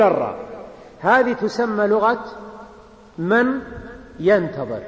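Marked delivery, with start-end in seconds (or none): none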